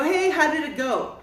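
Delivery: high, loud cheery hello; cheerful greeting. Retold or imitated. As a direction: call out happily as the friends return. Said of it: "and they go".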